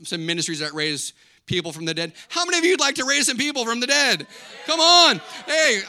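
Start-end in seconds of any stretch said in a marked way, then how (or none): 2.3-5.8 loud, high rallying call; rousing preaching. Real, not acted. Pastor rallying congregation to raise the dead, rousing preaching. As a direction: fire up the crowd, building to a shout.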